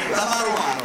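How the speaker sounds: hammed-up Roman shout; mock shout. Loud mock Roman flourish.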